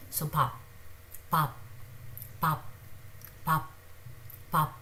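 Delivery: pap, neither bab nor phab; pronunciation demo. Shown as a demonstration. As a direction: say pap cleanly, between b and p. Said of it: "neither bab, nor bhab, nor phab".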